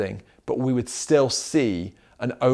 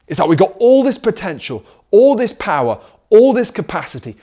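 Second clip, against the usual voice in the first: rising preacher intensity; 6 semitones higher, 6 dB louder than usual. Real, not acted. Preaching climax, building.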